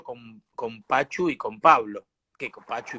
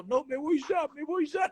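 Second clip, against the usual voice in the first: high-pitched fake crying; mock tearful wail; 12 semitones higher than usual. Actor faking tears to fool Freddy, mock crying. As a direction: whine it much higher, pretending to cry. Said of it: "decía yo llorando".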